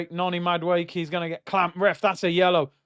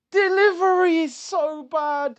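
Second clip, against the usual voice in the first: high, slow, drawn-out complaint; pained wail at the screen; exasperated groan; 9 semitones higher, 4 dB louder than usual. Real, not acted. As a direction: moan it out in real frustration. Fuming at a poor cross.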